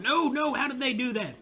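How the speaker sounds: high, loud disbelieving cry; can't believe what just happened; dismayed high-pitched protest; shocked dismay. Real, not acted. Town suddenly lost to Union.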